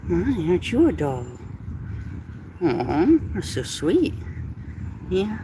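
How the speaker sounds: sweet coo at the kitty; cooing to a cat. Real, not acted. Baby talk to a cat.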